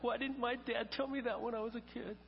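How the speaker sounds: high-pitched mock grievance; mock complaint. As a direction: imitate a listener's complaint, pitched well up. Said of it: "Yeah, you're like".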